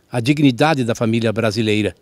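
ordinary talk

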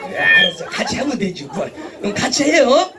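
normal talking voice

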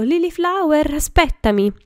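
high, loud call-out; shouting after someone. A narrator's reading. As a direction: yell for someone to wait while chasing. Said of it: "corse dietro la ragazza urlando".

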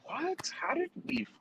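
imitating a high baffled squeak; high squeaky disbelief. Retelling the failed exam to friends, a high baffled squeak. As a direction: pitch it way up like a stunned student. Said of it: "we were like".

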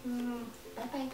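high, soft mock farewell; slow little goodbye. Suggesting a farewell to the man in the boot, soft and slow. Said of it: "like, slow".

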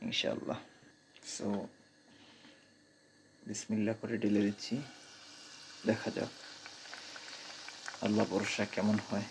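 soft late-night voice so others can sleep; gentle hushed talk at night; speaking softly. Real, not acted. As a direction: keep it hushed, everyone's asleep. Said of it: "সেই জন্য আস্তে আস্তে কথা বলছি".

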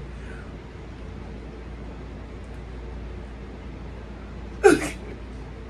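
long airy laugh; soft breathy laugh. Real, not acted. Vlogger surprised frog tastes fine, airy laugh. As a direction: laugh under your breath.

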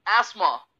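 एस्मा said as "esma", silent th; correct pronunciation. Shown as a demonstration. Teacher showing how to say एस्मा. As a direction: say एस्मा with no t sound. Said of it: "इसका सही उच्चारण है".

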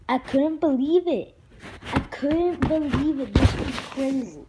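loud, high-pitched retold disbelief; shocked outcry. Retelling own reaction to dog's death.

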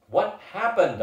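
high, loud demand; bellowing. A narrator's reading. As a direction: bellow the question over the chatter. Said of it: "I bellowed".

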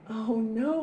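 ordinary delivery, nothing marked

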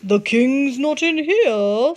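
slow sly fox voice. A narrator's reading. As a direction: pretend to search. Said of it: "said Foxy Loxy".

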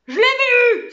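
high, loud shout of triumph; triumphant cry. A narrator's reading. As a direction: cry it out triumphantly at the top of your voice. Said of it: "s'écria-t-il d'une voix triomphante". (cri triomphant, aigu et puissant)

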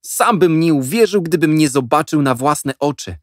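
put-on high boy's voice, eager; can't contain the news; boy Jack excited. A narrator's reading. Jack insisting to the boys he saw it, high and eager.

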